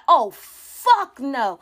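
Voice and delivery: drawn-out retold outrage; mock-appalled, long-held refusal; emphatic refusal. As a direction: stretch it out slowly, appalled. Grandma's outraged reaction, retold.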